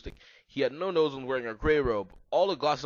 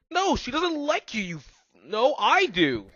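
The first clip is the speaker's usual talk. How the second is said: angry shout; 10 semitones higher, 8 dB louder, slower than usual. Acted. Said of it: "boys fighting over her".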